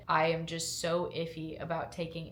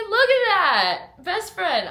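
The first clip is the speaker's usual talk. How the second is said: excited squeal; 13 semitones higher, 14 dB louder, slower than usual. Real, not acted.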